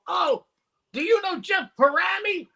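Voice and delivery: high-pitched imitation of keen golfers; eager retold greeting. Golfer asking the comedian about a mutual friend, eager. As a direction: act out a delighted question.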